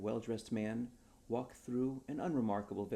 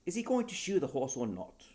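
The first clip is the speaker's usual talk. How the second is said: impatient; 7 semitones higher, 7 dB louder than usual. Acted. Impatient duke demands.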